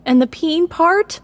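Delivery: high, loud, slow retort; shot back. A narrator's reading. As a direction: shoot back with disgust. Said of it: "Violet shot back".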